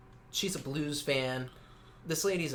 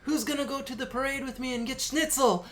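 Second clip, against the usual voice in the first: very high-pitched, badly faked German accent; thick German accent; 12 semitones higher, 4 dB louder than usual. Godmother rallying family for the hockey parade, mock German accent. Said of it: "and it's worse".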